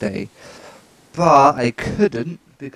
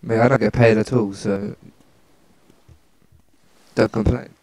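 usual speaking voice

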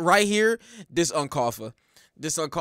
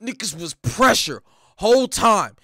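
usual speaking voice

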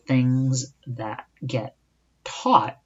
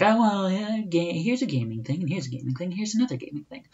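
higher, breezy list of topics; mock gamer patter; 6 semitones higher, faster than usual. Podcaster mocking his own lazy topic choices, in a higher lilting voice. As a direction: rattle off gaming topics in a lilting voice. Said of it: "It's like".

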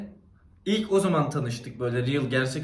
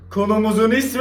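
loud; 6 semitones higher, 8 dB louder than usual. Real, not acted. Louder retake of intro.

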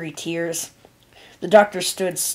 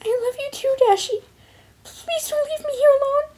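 very high, squeaky tearful plea; tiny, high-pitched cartoon voice; Pinkie Pie; 3 dB louder than usual. A narrator's reading. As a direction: beg a loved one to stay. Pinkie pleads with dying Rainbow.

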